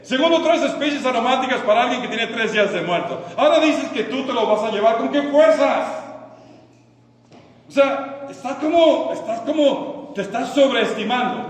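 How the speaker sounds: loud, high mock scolding; preacher's rhetorical disbelief, pitched high; incredulous challenge. Mock challenge to Mary's logic.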